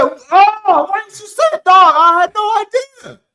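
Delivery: suddenly delighted and loud; excited recognition. Retold or imitated. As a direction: friend finally recognizes him, high loud excitement. Guy finally recognizing him.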